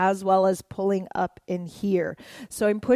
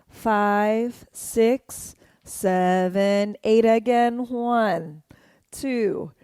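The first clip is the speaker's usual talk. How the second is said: dance count; much slower than usual. Shown as a demonstration. Counting along to dance moves.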